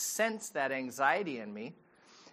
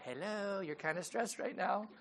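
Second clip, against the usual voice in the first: a hint of a gentle dog, softer; soft imagined dog; 3 semitones higher, 9 dB quieter than usual. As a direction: nudge quietly like a caring pet. Dog voiced gently nudging owner.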